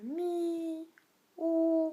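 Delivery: "mi", "ou" sustained high and level. Shown as a demonstration. Teacher showing learners the first tone, high level "mi, ou". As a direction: hold "mi" and "ou" on one high note. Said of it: "c'est quand même très plat".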